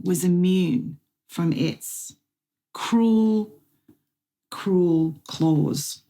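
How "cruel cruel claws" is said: ordinary delivery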